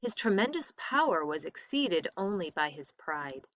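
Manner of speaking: ordinary delivery, nothing marked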